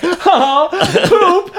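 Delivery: high, giggly fake-laughing squeal; mock an actor's forced giggle. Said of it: "their laughter is so fake".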